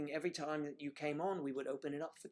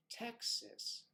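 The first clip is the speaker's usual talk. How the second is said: mock Texan accent; 9 semitones higher, 3 dB quieter than usual. Mocking Texan word.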